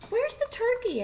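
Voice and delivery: high curious wife; high-voiced farm wife asking. A narrator's reading. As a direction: ask it as the farmer's wife. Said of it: "asked Farmer Jake's wife".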